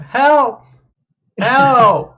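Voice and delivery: high, loud cries for help; damsel in distress. Acted. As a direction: cry out like a trapped lady. GM voices lady trapped under cart.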